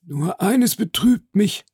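high, slow lament; theatrical regret. Acted. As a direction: sigh it out high and drawn-out. Major klagt theatralisch über Abreise. (theatralisch betrübt, hoch und gedehnt)

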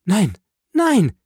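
high, desperate woman's cry; high-pitched outburst; acted cry. A narrator's reading. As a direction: cry out in horrified refusal. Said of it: "schrie sie".

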